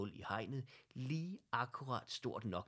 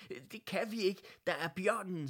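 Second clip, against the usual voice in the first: panicked objection; 10 semitones higher, 7 dB louder than usual. A narrator's reading.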